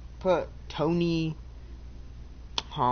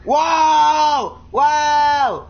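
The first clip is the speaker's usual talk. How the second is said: shouting wow; 7 semitones higher, 7 dB louder, much slower than usual. Real, not acted. Loud repeated exclamation.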